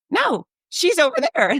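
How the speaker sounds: high, startled protest; surprised correction. Retold or imitated. Daughter corrects the announcer, high and startled.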